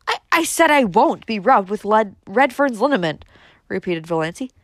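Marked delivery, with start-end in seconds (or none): 0.1-3.2 loud, stammering, heated insistence; defiant repeat. A narrator's reading. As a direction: hammer the refusal home. Repeats her refusal, more forcefully.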